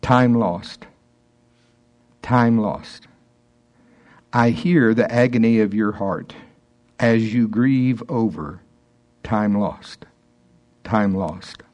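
hushed prophetic word; solemn voice of God. Acted. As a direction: speak it gently as the Lord grieving with her. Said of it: "And he says, quote".

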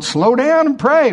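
slow, high-pitched exhortation; God urging. Acted. God telling a workaholic to pray, slow urging. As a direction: call it out slowly, well above normal pitch. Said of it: "And God's going".

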